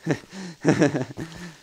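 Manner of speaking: real laugh after a scare; relieved laugh. Real, not acted.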